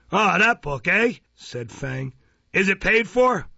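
high, loud, knowing query; Mister Fang, pouncing. A narrator's reading. Magistrate catching out the prosecutor, pouncing.

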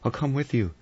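high-pitched, desperate surrender. A narrator's reading. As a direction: give in anxiously, pitched right up. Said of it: "Vera said desperately".

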